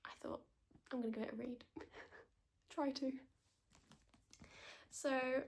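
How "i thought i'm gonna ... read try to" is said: hushed whisper, no voice; library whisper. Real, not acted. Library vlog, hushed aside.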